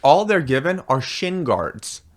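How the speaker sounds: ordinary delivery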